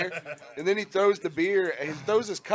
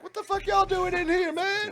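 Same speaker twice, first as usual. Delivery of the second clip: very high-pitched mock yell; yelling at customers; 3 dB louder than usual. Drunk manager turns on other customers, high angry yell. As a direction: shriek at them like a drunk manager. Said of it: "hear him yelling at other customers".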